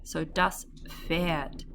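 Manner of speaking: "das Pferd" with a silent P, said as "Ferd". Shown as a demonstration. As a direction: say "Pferd" starting on an F sound, no P. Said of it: "we'd say the F".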